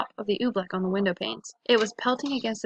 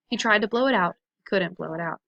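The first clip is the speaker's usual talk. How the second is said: usual speaking voice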